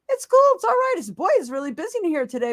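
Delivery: upbeat shopper telling a stranger it's cool; optimistic voice. Retold or imitated. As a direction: brush it off cheerfully to a stranger. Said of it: "I said it with an optimistic voice".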